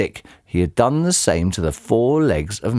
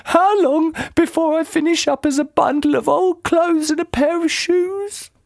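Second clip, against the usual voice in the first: put-on much higher, dim old woman's voice; squeaky puzzled old lady; Missus Twit voice. A narrator's reading. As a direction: play her as a high, befuddled old wife.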